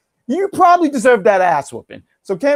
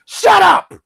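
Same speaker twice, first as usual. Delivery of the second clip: loud, high, sharp "Shut up"; sudden shout; 11 semitones higher, 6 dB louder than usual. Real, not acted. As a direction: shout it down as a joke. Cuts off his own mock quote.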